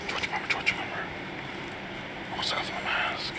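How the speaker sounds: urgent whisper. Real, not acted. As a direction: whisper so nobody hears. Hiding from fans in disguise.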